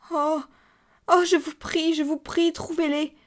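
high, anguished begging; cry of suffering. A narrator's reading. Cousin begging the narrator for the money, in anguish. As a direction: beg desperately, voice pitched right up. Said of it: "une sorte de cri de souffrance".

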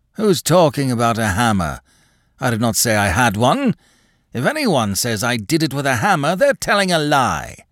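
agitated, climbing protest; indignant denial. Acted. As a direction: deny it hotly, building to the accusation. Cornered old man angrily denies it.

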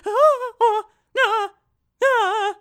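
high, loud loon cries; imitated loon wail; loon call. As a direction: honk out wild loon calls. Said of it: "I became a loon".

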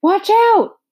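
put-on urgent boy's yell. A narrator's reading. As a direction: scream the warning. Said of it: "and then he yelled".

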